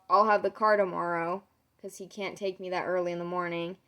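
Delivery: ordinary talk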